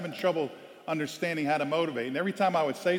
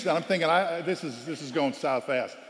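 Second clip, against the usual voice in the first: normal talking voice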